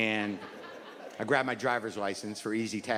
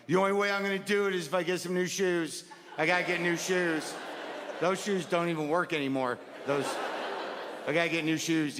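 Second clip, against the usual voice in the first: pleading, whiny inner voice about new shoes; whiny self-talk; 5 semitones higher than usual. Retold or imitated. Comedian mocking his morning self, whiny and pleading. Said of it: "you wake up and you're like".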